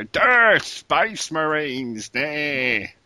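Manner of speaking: mock orc voice, starting loud and high, then drawn out; orc accent. Gamer mocking how the orcs talk in the game, hammed-up orc voice. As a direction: imitate a brutish game orc, slowly stretching the words. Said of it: "I don't like the way they go".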